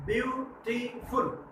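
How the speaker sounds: Beautiful stretched out slow and soft, one syllable at a time; slowly split into syllables. Shown as a demonstration. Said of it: "इसमें कितने syllable है?".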